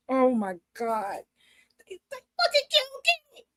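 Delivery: shocked disbelief. Real, not acted. Fan reacting to a wrestler being beaten, stunned and high. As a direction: squeal in disbelief.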